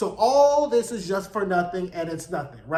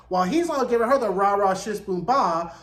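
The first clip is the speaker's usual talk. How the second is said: ordinary talk, nothing marked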